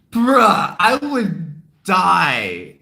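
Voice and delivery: loud, drawn-out dying groan; loud mock agony. Real, not acted. Telling viewers how her voice felt, loud and drawn-out. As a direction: stretch it out slowly, like you can't bear it.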